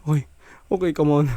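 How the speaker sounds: normal talking voice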